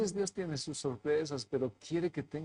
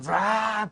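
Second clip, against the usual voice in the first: ruido de arranque imitado; 7 semitones higher, 7 dB louder than usual. Retold or imitated. Auto arranca a toda prisa.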